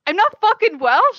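loud, high, indignant denial; fed up with chat guessing wrong; exasperated protest. Real, not acted. To the chat, a loud indignant denial.

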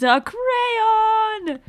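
high, slow, drawn-out crayon exclamation; excited guess. Real, not acted. Player guessing the clue for the game master, excited. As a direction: cry it out like you just solved it.

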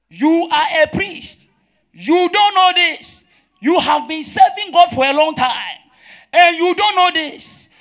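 loud, high preacher's reprimand, words drawn out; indignant rebuke. Acted. Preacher voices rebuke of a priest lacking knowledge, indignant. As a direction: raise the voice in an incredulous scolding.

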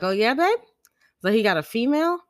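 high, loud mock suspicion; teasing. Wife giving husband a hard time, teasing. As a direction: needle with loud, rising questions. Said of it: "Just giving him a hard time".

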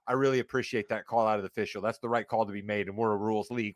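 low, hurried podium voice; mock coach statement. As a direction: imitate a coach dutifully reading a statement.

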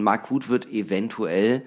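usual speaking voice

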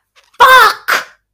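high, loud burst of fury; sudden swear. Retold or imitated. Mother swearing as the tent pole snaps, loud outburst. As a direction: let it explode out of you. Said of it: "erupts from my face".

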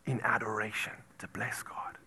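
soft, reverent whisper. Acted. Preacher whispering about blessing God. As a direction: hush it like holy ground.

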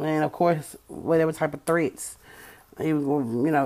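ordinary delivery

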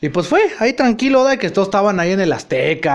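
usual speaking voice

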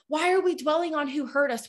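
ordinary talk

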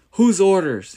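high, loud outraged bellow; outraged king bellowing at his queen's accuser. Acted. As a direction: roar the question in fury. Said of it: "he bellowed".

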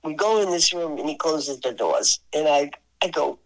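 ordinary talk

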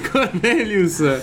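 slow, high laugh on the name. Real, not acted. Laughs at Cornelius assist. (ridendo a lungo sul nome)

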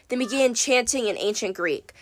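usual speaking voice

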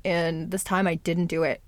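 ordinary talk, nothing marked

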